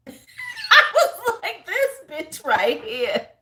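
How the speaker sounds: laughing it out, high and slow; can't get it out for laughing. Real, not acted. Laughing at the woman on the dating show.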